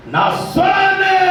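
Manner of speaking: high, slow, loud proclamation; a preacher shouting with joy that his voice was restored; triumphant shout. Real, not acted. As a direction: shout it out as a victory.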